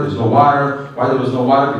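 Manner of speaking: ordinary talk, nothing marked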